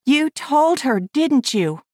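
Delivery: put-on high girlish voice, accusing; a child catching her father out. Millie accusing her father, high girlish voice. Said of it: "Millie's voice, however, was full of accusation".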